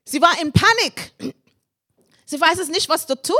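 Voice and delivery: usual speaking voice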